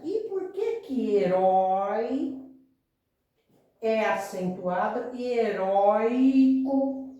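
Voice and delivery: slow, pointed question to the class; slow questioning emphasis. Shown as a demonstration. Teacher slowly asks the class why heróico differs, drawn out. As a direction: stretch heróico out, pitch up at the end. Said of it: "me respondam uma coisa".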